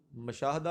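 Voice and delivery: مشاہدہ as "ma-shah-da", a first; pronunciation option. Shown as a demonstration. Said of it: "درست تلفظ والا".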